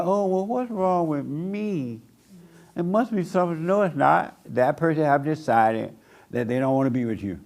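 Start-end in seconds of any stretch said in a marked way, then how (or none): 0.0-3.6 mock self-pity. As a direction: imitate a moping heartbroken person. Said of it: "don't be saying".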